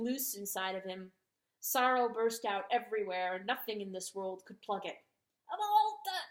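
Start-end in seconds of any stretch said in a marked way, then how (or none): 5.5-6.2 high exasperated choked outburst. A narrator's reading. Rooster choking on his anger at the dog. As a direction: sputter in disbelief. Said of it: "Chanticleer choked".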